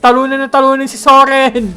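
shouted hype chant; excited cheer. Real, not acted. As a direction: pump up the team. Hyping up beating Soren.

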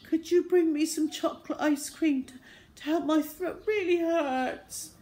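put-on high, pitiful poorly-child voice; feigned weakness. Boy asking mum for ice cream, pretending to be weak. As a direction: whimper the request, dragging out the end. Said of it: "trying to sound as weak as possible".

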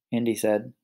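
usual speaking voice

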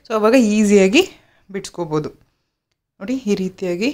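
lips stiff from drying mask; talking through tight mask film; speaking with a face mask on. Real, not acted. Seen 0: Drying clear mask film on face around lips; lips bare.

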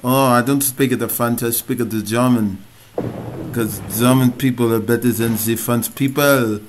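hammed-up French accent; playful fake French accent, boasting; mock French accent. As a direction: put on a cartoonish French accent. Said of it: "that was my French accent".

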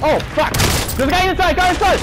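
high urgent yell; alarmed shout. Real, not acted. Enemy bursts in, alarmed callout.

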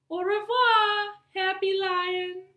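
high, sing-song au revoir; cheerful goodbye. Acted. Madame Pinson bids the lion goodbye in a high cheery voice. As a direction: wave goodbye with the voice.

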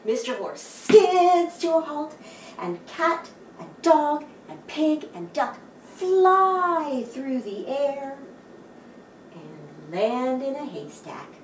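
ordinary delivery, nothing marked

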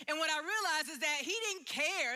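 usual speaking voice

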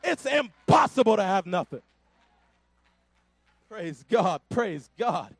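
rousing Pentecostal sermon voice; full-force preaching. Acted. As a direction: preach it to the rafters, calling the church to answer.

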